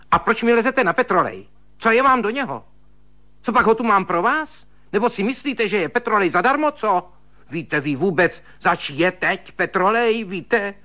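high, fast shrill scolding. A narrator's reading. Stingy sister-in-law scolds him over the cost of petroleum, high and fast. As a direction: tear into him with high, rapid nagging.

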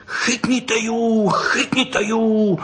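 high, drawn-out holler; high-pitched boyish yell; yelling boys. A narrator's reading. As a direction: call out the chase, stretching each word. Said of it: "řvali oba upřítež".

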